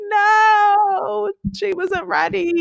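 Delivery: anguished no. Real, not acted. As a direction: wail it as if losing a friend. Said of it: "I was like".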